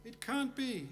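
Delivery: high, soft mock wail of disbelief; mock audience disbelief. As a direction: whisper it like a crowd in mock despair. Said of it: "I hear you say".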